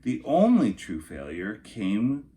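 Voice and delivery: storybook narration for kindergartners; lilting picture-book reading voice; reading to children. Acted. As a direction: read it like story time for little kids. Reads picture book to class.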